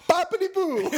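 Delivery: high silly gondolier voice, laughing; hammed-up Italian boatman; mock gondolier. Mocking Venetian gondolier voice.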